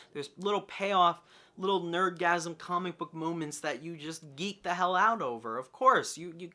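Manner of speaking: usual speaking voice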